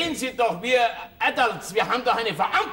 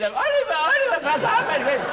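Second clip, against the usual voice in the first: shrill tennis mother; 7 semitones higher than usual. Retold or imitated. Pushy mother yelling to her son Oliver on court, mock screech. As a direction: ham up a pushy tennis mum calling out. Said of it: "diese Leute mit dem".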